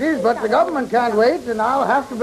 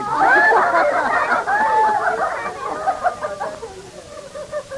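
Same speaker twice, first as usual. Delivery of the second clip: big laugh. Acted. Man laughs at own joke.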